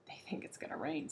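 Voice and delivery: softly whispered secret. Acted. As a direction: whisper it so the snakes can't hear. Gumble confides about the bottersnakes.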